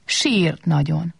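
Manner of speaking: ordinary delivery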